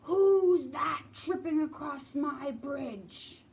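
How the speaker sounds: slow, loud troll voice; menacing troll roar; mean troll. Acted. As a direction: bellow down from the bridge. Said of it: "a mean troll".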